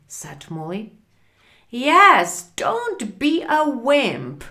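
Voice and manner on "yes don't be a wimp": loud, higher-pitched jeer; taunting. Acted. Sam mocks Frank in the car, taunting. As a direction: goad a scared friend.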